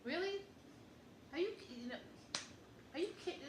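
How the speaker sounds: high, rising really; sharp upward disbelief; real surprise. Real, not acted. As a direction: say it genuinely taken aback. Reacting to the vacation idea.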